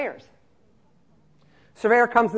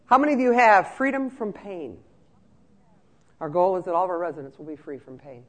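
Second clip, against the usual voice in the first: ordinary talk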